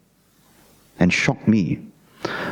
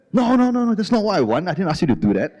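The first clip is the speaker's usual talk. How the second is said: angry protest; 9 semitones higher than usual. Retold or imitated. Husband protesting to wife about the short haircut, high and angry. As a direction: push back hard, fed up.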